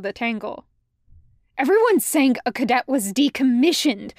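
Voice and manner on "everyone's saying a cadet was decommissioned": loud, alarmed blurting; wild and scared. A narrator's reading. Fives passing on a frightening rumor, scared and loud. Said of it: "eyes wild and scared".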